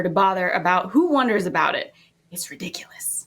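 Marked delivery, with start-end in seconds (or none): 2.3-3.3 whispered. Acted. Indignant aside in scene.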